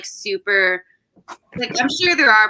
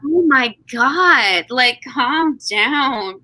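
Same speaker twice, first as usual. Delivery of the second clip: drawn-out, exasperated plea; 3 semitones higher, 4 dB louder, much slower than usual. Acted. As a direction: drag it out, fed up with the fuss. Exasperated at the handkerchief fuss.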